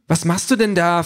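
high-pitched cross wife; annoyed. A narrator's reading. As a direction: demand an answer, indignant and high. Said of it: "fragt Denise verärgert".

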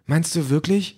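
high-pitched doubting wife; Denise. A narrator's reading. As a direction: ask for reassurance, high and hopeful. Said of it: "fragt Denise".

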